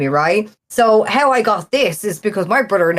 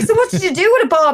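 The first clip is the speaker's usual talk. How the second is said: higher, keen retold voice; eager question; 10 semitones higher, faster than usual. Speaker asking Bob about the rescued PlayStation, eagerly high. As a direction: push for the juicy bit, pitched up.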